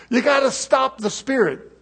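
ordinary talk

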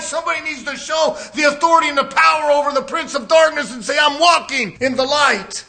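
loud, high, impassioned preaching; preaching at full force. Real, not acted. Father and grandfather refusing to lose his family, full-force preaching. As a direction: press the warning home at full voice.

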